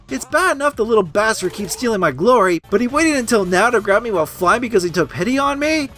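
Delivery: usual speaking voice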